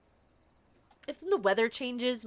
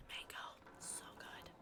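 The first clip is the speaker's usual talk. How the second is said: whisper; 23 dB quieter than usual. Real, not acted. Savoring a mango.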